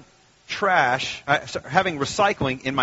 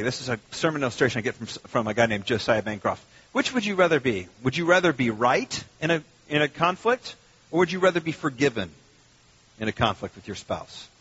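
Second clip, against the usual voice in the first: ordinary delivery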